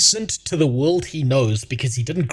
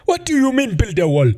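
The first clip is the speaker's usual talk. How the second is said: mock shout as the indignant orc, high on the first words; mock orc outburst; 8 semitones higher than usual. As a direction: yell it as an outraged orc. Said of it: "first appears on screen and goes".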